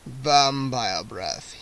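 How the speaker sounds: low, very slow dreamy vampire murmur; long for it aloud. A narrator's reading. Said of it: "he repeated".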